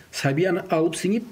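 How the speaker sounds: ordinary talk, nothing marked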